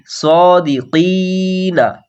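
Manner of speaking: very slow সদিকিনা, স drawn out one alif; long first vowel. Shown as a demonstration. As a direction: draw out the স. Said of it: "স এক আলিফ টান দিয়ে". (সদিকিনা শব্দে স এক আলিফ টান)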